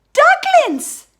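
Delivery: "ducklings" leaps high and loud; big reveal. A narrator's reading. Narrator revealing to the children the babies are ducklings, "ducklings" sung out high and loud. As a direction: land the surprise on "ducklings".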